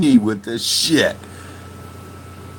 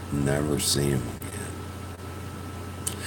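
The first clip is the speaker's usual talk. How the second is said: hurt and quiet; 12 dB quieter, slower than usual. Real, not acted. Sad about losing friend.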